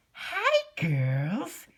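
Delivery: high, bright, loud greeting; high Twilight greeting. A narrator's reading. Narrator quoting Twilight greeting the girls, high and loud. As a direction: chirp it like a cheerful pony. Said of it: "a lot like Twilight when she said".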